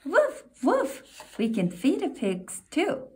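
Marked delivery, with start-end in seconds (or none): 0.0-1.0 high playful yapping woofs; puppy bark. Acted. Reading to a child, puppy Biscuit barks high. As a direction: bark like a little puppy, much higher.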